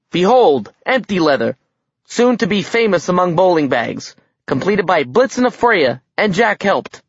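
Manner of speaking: high, emphatic unveiling; grand presentation. A narrator's reading. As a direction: announce it like a showpiece. Dwarf proudly presents his creation.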